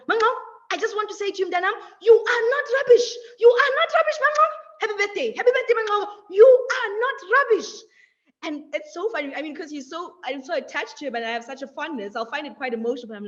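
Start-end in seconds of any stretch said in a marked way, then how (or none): 0.1-7.7 higher, louder, fond grandfather voice; loving grandfather. Retold or imitated. Grandfather phoning on birthday, loudly affectionate. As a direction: beam it like a proud grandpa. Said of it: "he'll say to me on the phone".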